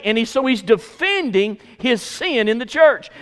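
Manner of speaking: ordinary delivery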